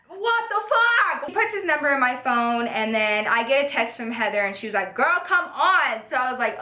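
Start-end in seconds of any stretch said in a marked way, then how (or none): 0.1-1.3 high, drawn-out exclamation; hammed-up, slow, high-pitched shock; stunned disbelief. Amazed at sudden date. 4.9-6.0 high, drawn-out summons from a friend; mock-exasperated hurry-up; impatient nagging. Friend impatiently calling her in retelling.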